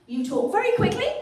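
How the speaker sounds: high-pitched, quick-fire British caricature; British accent demo. Shown as a demonstration. Comic showing audience a great British accent, British demo. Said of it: "how to do a great British accent".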